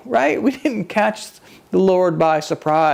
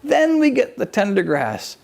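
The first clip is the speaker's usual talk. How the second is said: higher, exasperated voice of the Lord scolding fools; the Lord chiding; 8 semitones higher than usual. Pretend. Lord scolding people who miss the signs, higher and exasperated. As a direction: shake them awake like a fond but impatient teacher. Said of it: "Would he say".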